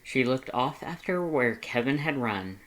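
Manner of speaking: ordinary delivery, nothing marked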